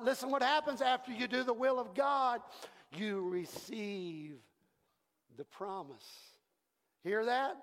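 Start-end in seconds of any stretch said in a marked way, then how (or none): none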